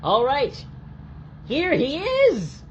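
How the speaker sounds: high, loud, slow showman announcement; announcer. Pretend. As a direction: present him like a game-show host. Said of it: "you'll say something like".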